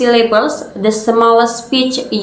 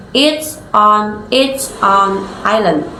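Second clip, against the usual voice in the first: slow "It's on its own island", broken word by word; pauses between words. Shown as a demonstration. Presenter showing unnatural unconnected speech, big pauses between words. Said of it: "with big pauses in between".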